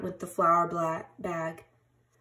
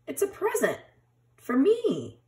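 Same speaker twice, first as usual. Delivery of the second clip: surprised delight; 8 semitones higher than usual. A narrator's reading. Bear finds a present.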